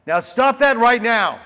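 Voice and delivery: loud, sharp parental scold; mock stern dad telling off a child. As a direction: tell the kid off like a dad. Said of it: "a parent who says".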